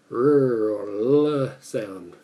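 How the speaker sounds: long, loud drawn-out "R or L", blurred between the two; halfway R-L sound. Shown as a demonstration. Speaker demonstrating the Japanese sound between L and R, drawn-out blur. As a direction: stretch "R or L" slowly and loudly. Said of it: "It's very hard to demonstrate".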